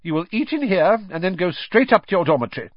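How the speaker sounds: high, fast, clipped schoolmistress voice; stern teacher. Acted. Stern teacher sends them off.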